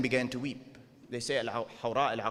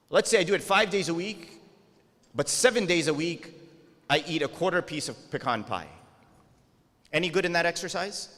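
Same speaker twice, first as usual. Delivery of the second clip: fired-up preaching; 5 semitones higher, 4 dB louder than usual. Real, not acted. Preacher building an example to the crowd.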